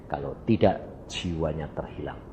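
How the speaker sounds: jauh lebih rendah dan lebih pelan, suara Tuhan yang serius; jawaban Tuhan. Retold or imitated. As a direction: ucapkan peringatan Tuhan dengan rendah dan berat. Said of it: "Kenapa, Tuhan?". (Tuhan menjawab pertanyaan pendeta, sangat rendah dan pelan)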